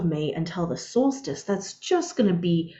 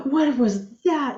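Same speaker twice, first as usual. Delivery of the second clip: high, slow startled question; jolted awake by a roaring exhaust; 6 semitones higher, slower than usual. Retold or imitated. Startled by loud car at night.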